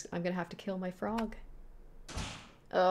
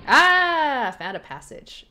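ordinary talk, nothing marked

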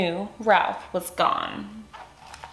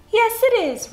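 much higher, proud silky monster reply; Ralph's silky voice; 3 dB louder than usual. Acted. Ralph proudly confirms his nail polish, high silky voice. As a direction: preen a little, high and silky. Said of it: "Ralph replied".

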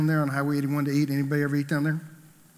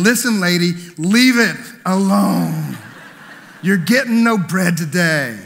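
louder, slow, laughing mock rebuke; mock telling off; 4 semitones higher, 9 dB louder, slower than usual. Preacher imagining telling the bread lady off, mock stern. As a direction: scold the lady, half-joking. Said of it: "thinking about going over there and saying".

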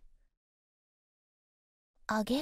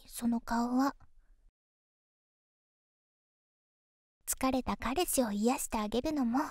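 pillow talk; 2 semitones lower than usual. Acted. Girlfriend soothing tired boyfriend.

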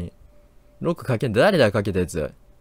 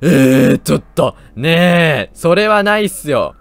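loud, high protest at the chaser; panicked yelling; 7 semitones higher, 7 dB louder, slower than usual. Real, not acted. Fleeing the game monster, yelling in loud protest. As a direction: yell it's not fair, running away.

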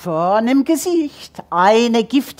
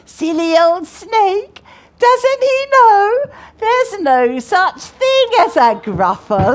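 higher, louder and slow, teasing the reader; slow sing-song refrain; 7 semitones higher, 3 dB louder than usual. Shown as a demonstration. Reader teasing the snake, slow high sing-song. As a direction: draw the refrain out, mocking the silly animal. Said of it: "refrain read two ways".

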